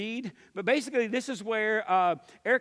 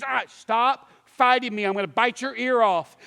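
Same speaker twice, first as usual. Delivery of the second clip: forceful warning; 3 semitones higher, 8 dB louder than usual. Retold or imitated. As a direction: bark at a struggling swimmer to give up. Exasperated second warning to struggling kid.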